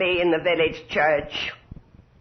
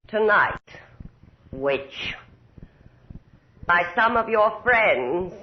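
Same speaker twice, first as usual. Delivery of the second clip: ordinary delivery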